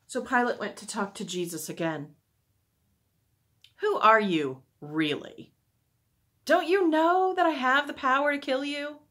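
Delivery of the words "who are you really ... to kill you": loud challenge, climbing high; angry demand. A narrator's reading. Pilate questioning Jesus about who he is, angry demand. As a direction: press the prisoner, rising to a high, loud threat.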